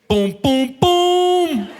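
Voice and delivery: high, loud imitated PA chime; chime imitation. Comedian imitating the loudspeaker chime, high and loud. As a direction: sing out the ship's chime. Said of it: "you hear boom".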